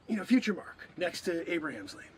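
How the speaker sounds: ordinary talk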